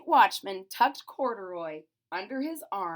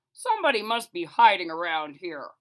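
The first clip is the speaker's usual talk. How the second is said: watchman voice; 9 dB louder than usual. A narrator's reading.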